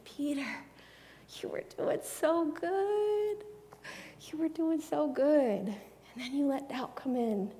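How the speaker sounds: high, drawn-out loving lament; tender encouragement. Acted. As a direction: fondly console someone who nearly made it. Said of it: "It feels like him to say".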